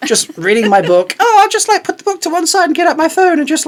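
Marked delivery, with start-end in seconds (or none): 1.2-3.7 high, louder fanboy excitement; giddy inner voice. Retold or imitated. Telling friends his excited plan on spotting her, high and giddy. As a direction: scheme it giddily in your head. Retelling nervous inner monologue.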